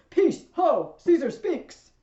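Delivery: very loud, high crowd-silencing shout; herald's full-voiced command; shouting for quiet. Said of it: "he needs to quiet everybody down".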